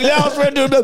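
a lightly voiced high fish answering. (let spillet fisk, høj stemme)

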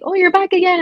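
mock the PR guy's surprise at seeing her again; surprised greeting. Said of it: "he's like".

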